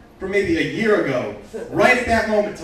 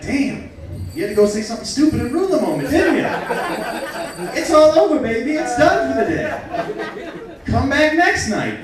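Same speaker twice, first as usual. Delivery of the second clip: mock exasperated rant; 8 semitones higher, 3 dB louder than usual. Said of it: "So I was like".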